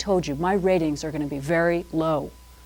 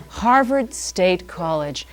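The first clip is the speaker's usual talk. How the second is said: savored slowly; slower than usual. Shown as a demonstration. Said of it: "I get pleasure saying that".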